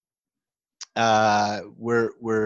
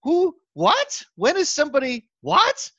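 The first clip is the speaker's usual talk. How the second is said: high, loud stunned what; shocked disbelief; 5 dB louder, slower than usual. Retold or imitated. Retelling his shocked reaction to the surprise email, high and loud. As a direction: sputter in total disbelief. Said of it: "my response was".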